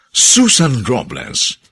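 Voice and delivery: ordinary talk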